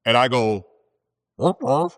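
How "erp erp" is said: mock confused squeak. Fumbling answer to advisor.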